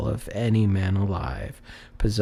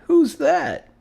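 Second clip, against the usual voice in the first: much higher, louder alarmed question; startled question; 6 dB louder than usual. Acted. Fred, startled, asks who has come in, high and loud. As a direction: jump at the unexpected guest.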